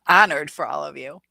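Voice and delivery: usual speaking voice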